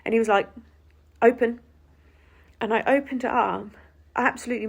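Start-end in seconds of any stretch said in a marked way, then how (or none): 1.2-1.6 louder, flat command; repeated curt order. Retold or imitated. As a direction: bark the order again.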